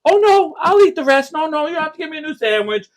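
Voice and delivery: high-pitched, apologetic dad impression; hammed-up meek surrender; mock tough guy caving. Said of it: "Mister Tough Guy comes back".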